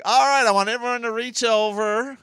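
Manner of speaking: loud, high hammed-up crowd address; stage comic shout. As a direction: bellow it at the audience for laughs. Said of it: "I'm like".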